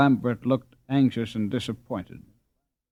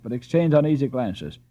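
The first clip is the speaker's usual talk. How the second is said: ordinary delivery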